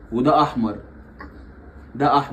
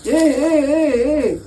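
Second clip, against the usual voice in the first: much higher, playful cooing; 12 semitones higher than usual. Real, not acted. Excited baby talk.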